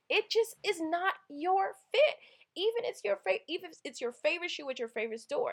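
high, loud, insistent delivery; emphatic advice. Retold or imitated. Guy tells the heartbroken girl it's not her fit, high and loud. Quoting guy's advice, climax.

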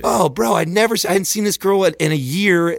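high-pitched exasperated protest; incredulous protest. Real, not acted. Storyteller defends himself to friends, incredulous. Protesting the girl's angry accusation.

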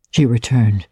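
ordinary delivery, nothing marked

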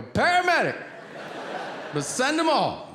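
high, loud blurted yell; excited shout. Retold or imitated. Caller answering the operator, loud excited shout.

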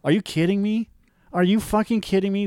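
high, exasperated mock yell. Yelling at Facebook comments, exasperated mock yell. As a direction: throw it at the screen in disbelief. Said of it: "yelling at my screen".

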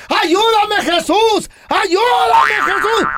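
high, drawn-out mock plea; comic shrieked prayer for rescue; imitated screaming for help. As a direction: cry out desperately, hammed up for laughs. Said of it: "y gritando".

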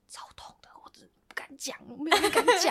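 hushed confiding whisper. Retold or imitated. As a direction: whisper it like a shameful secret. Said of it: "他就小小声压低声音". (压低声音的悄悄话)

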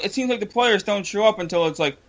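normal talking voice

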